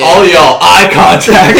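loud, high mock order; commanding shout. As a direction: holler for everyone's attention. Player calls everyone to attention.

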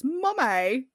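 put-on playful British-accented call for mother. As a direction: imitate a kid putting on British. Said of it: "a British accent".

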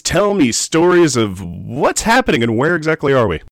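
usual speaking voice

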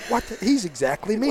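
a high, amused "wait, what?" realization; mock disbelief. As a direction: act like you just caught your own habits in your kid. Dad realizing son mimics him.

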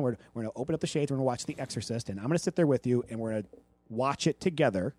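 lightly voiced mother, soft and patient; reassuring mom. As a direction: calmly reassure the child. Mother's calm reassuring plan.

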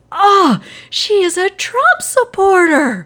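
high, loud laughing gasp of disbelief; mock horrified realization. Host acting out the hero's dismay, laughing and high-pitched. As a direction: play the shock for laughs.

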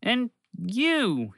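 high, eager burst; excitedly. Acted. Bobby greets Fred whose name he forgot, high and eager. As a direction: point at him with delight. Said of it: "he said excitedly".

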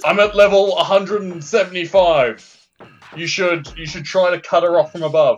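high, loud commanding character voice. Acted. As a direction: bark orders over the radio. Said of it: "is that your character voice".

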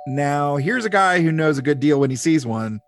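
upbeat, projected announcer read; podcast intro. Acted. Host opens Star Trek podcast for fans new and old, announcer style. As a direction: welcome the fans like a show announcer.

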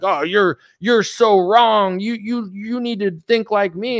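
a slow, drawn-out mock scold from a cranky boomer; mock boomer yell. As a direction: lightly ham up an angry boomer lecturing you. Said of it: "is screaming at me and being like".

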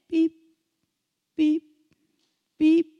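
short, evenly spaced electronic beeps; imitated beeps. Shown as a demonstration. Preacher imitating the chip in your head, steady beeping. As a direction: beep like a device, pausing between each.

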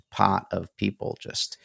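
ordinary talk

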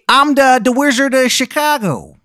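put-on loud, high, terrible Chicago accent; booming cartoon Chicago accent, pitched high; Wizard of Chicago. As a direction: announce it in a hammed-up Chicago accent. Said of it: "worst Chicago accent you've ever heard".

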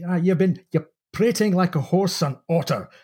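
a Scots sailing master fuming; Scottish accent. Retold or imitated. Sailing master's Scottish outburst at the purser. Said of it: "phoneticizes this Scottish accent".

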